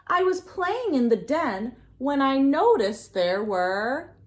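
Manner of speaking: ordinary delivery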